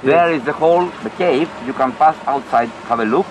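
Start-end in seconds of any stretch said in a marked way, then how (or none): none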